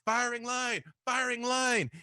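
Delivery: high barked command; Jake calling out. Acted. Jake orders his men into a firing line, high barked call. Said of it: "you hear Jake goes".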